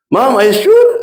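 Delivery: high, loud astonished question; surprised disbelief. Retold or imitated. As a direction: gasp it out in disbelief. Son stunned by mother's question. (kaget tak percaya, suara tinggi dan keras)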